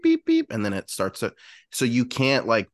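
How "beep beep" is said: high electronic beep; imitated car beep. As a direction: beep like the trunk alarm. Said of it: "it goes beep".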